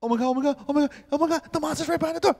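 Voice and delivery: terrified moviegoer squealing at the screen; panicked viewer. Retold or imitated. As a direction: squeal it like a terrified first-timer.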